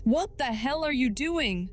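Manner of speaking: high-pitched alarmed cry at normal volume; mock shout. A narrator's reading. Yak reacts as Lu Shai cuts his hand, restrained shout. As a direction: shout it in pitch only, held back in volume. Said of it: "Yak shouts".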